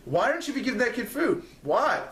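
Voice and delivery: fed-up call-out to the charity guy; indignant. Real, not acted. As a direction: push the question up in disbelief. Repeats demand, voice rising.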